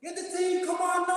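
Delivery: imitating mum, high-pitched scolding call; mock telling-off from a bossy mother. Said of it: "And then cuss me".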